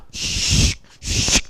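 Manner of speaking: soft unvoiced hissing snake sound; sharp hissing snake noise. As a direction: hiss like a snake slithering in. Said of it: "하는 소리가 들리더니".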